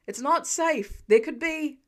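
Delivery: slow, loud warning shout; fireman shouting. Acted. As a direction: shout him down off the sill. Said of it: "the fireman shouts".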